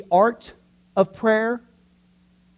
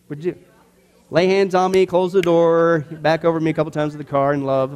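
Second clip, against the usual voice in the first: usual speaking voice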